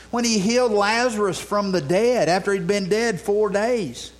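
ordinary talk, nothing marked

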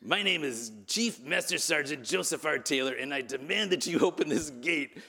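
cartoonish sergeant announcing his full rank; puffed-up mock demand; Joseph R. Taylor. Preacher mimics bandmate with fists tightened, pompous demanding voice. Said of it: "He says".

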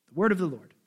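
measured, solemn reading of the Gospel; scripture reading. Acted. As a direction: read it to the congregation with weight. Pastor reads the Gospel aloud.

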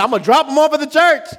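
high, fast and breezy; mock careless mom. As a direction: toss it off like a carefree parent. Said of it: "she's like".

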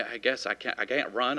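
ordinary talk, nothing marked

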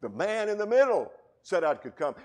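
no brogue, ringing pulpit delivery; plain preaching. Shown as a demonstration. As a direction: shout it out as your own proclamation. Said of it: "in that Alistair Begg Scottish brogue".

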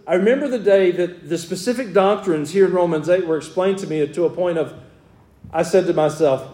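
ordinary talk, nothing marked

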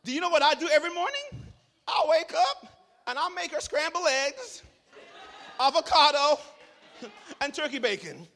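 high, loud and fast with laughter; excited exclamation. Real, not acted. Preacher exclaiming about making his wife breakfast. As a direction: burst out with joy.

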